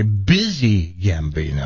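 "Busy" said slowly with a B at the start; corrected pronunciation. Shown as a demonstration. Host corrects the name, slow "Busy" with a B. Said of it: "I'm sorry".

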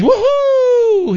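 very high whooping cheer; cheering buddies. Acted. Buddies hearing drinks are free, high drawn-out whoop. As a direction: whoop it up like a thrilled bar crowd.

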